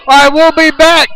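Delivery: high, loud radio break; excited announcer. Acted. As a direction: hype the break. Sign-off to break.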